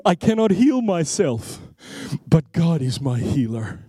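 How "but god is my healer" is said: usual speaking voice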